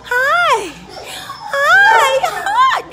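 high squealing hi to the dog; excited greeting. Real, not acted. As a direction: squeal hello to your dog.

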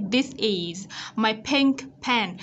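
slow "this is my pink pen" with released k; teacher models the k ending in "pink"; careful, drawn-out "this is my pink pen"; ending sound demo. Shown as a demonstration. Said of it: "Ở đây có đánh dấu ending sound pink".

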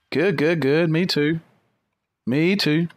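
ordinary talk

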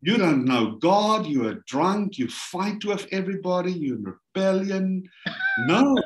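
high-pitched scolding, rising to an emphatic peak; mounting, high, acted indignation; indignant wife. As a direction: imitate a wife listing his faults in outrage. Wife's outraged reaction, retold.

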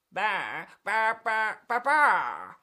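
string of bleated baas; sheep bleating. Acted. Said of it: "said the sheep".

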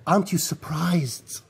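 whispered, voiceless and soft; hushed whisper. Retold or imitated. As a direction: lean in and whisper the question. Prophet quietly asks Ibn Abbas.

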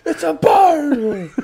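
very high, long drawn-out yell; hammed-up overexcited bystander scream; excited shout. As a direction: holler it, stretching every word. Mocks the shouting onlooker.

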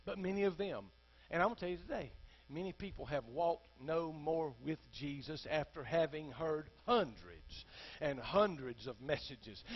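usual speaking voice

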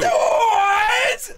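high, long shriek of disbelief; excited squeal. Real, not acted. Friend hears they get to meet, excited squeal. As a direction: squeal it out, overjoyed.

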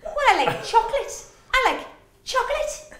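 exaggerated Geordie accent, laughing through it; Sarah Millican. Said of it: "she always goes like".

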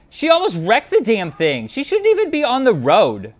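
high, loud indignant outburst; rising disbelief at the screen; shocked and scandalized; outraged exclaiming. Real, not acted. Reacting in outrage to video.

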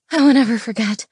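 usual speaking voice

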